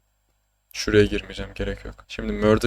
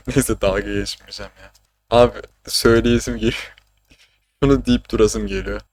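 ordinary talk

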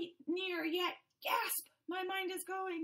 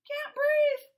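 much higher, louder choking cry; desperate shout; 11 semitones higher, 11 dB louder than usual. Acted. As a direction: cry out as air runs out. Fish suffocating.

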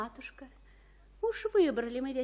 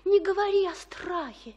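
insistent; 6 semitones higher, 7 dB louder than usual. Acted. Juliet insists she is brave.

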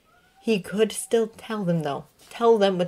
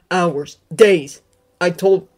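distressed; 8 dB louder, much slower than usual. A narrator's reading. Harry's rising distress.

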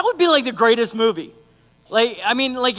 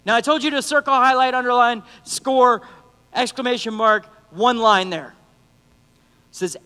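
normal talking voice

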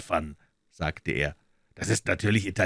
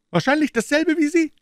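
very high, loud character voice; Gregson-style police detective voice; 8 dB louder than usual. Acted. Detective answering Holmes at the door, high put-on voice.

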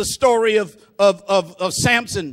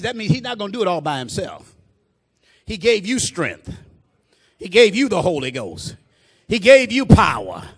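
ordinary delivery

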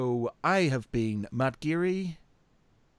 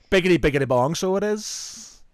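hammed-up Irish accent, lilting up high; thick Irish accent; 4 semitones higher, 5 dB louder than usual. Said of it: "in a really thick Irish accent".

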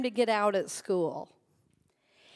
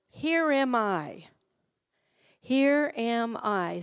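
slow, reverent; much slower than usual. Shown as a demonstration. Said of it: "Say that with me".

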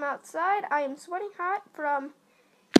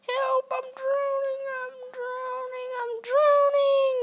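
high, panicked cries for help; mock drowning, voice up high; 8 semitones higher than usual.

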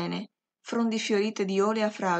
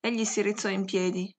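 ordinary delivery, nothing marked

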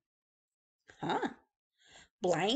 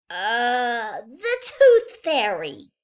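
put-on childish made-up excuse; 3 semitones higher, 8 dB louder, much slower than usual. A narrator's reading. Little girl blaming the Tooth Fairy, loud and slow. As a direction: stretch out the fib as it comes to her.

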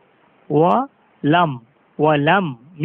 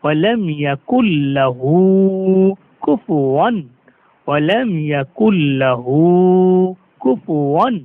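slow recitation; slower than usual. Shown as a demonstration. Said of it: "recitation demo".

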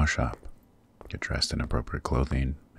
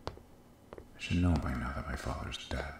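hushed, brooding to himself; muttered aside; 11 dB quieter than usual. Acted. King alone, fuming over harm to an innocent, muttered aside. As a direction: keep it far softer, as if thinking aloud.

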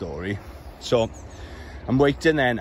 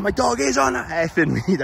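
higher, sharp angry retort; retold shout back; 7 semitones higher than usual. Dog walker shouting back at lorry driver, angry. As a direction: snap it back at him, pitched up. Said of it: "I shout, he was aggressive".

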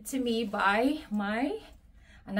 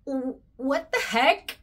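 shocked; 3 semitones higher, 6 dB louder than usual. Retold or imitated. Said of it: "And I'm like".

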